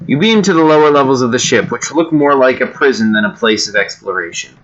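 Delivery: ordinary delivery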